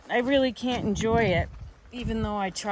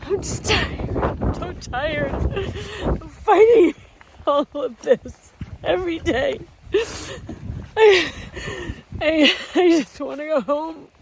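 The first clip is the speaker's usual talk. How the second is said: high, loud, tearful and exhausted; real sobbing, voice breaking; crying; 8 semitones higher, 7 dB louder than usual. Real, not acted. Breakdown continues.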